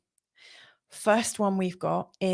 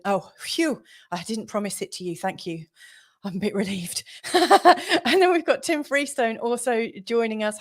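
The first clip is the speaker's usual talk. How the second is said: ordinary delivery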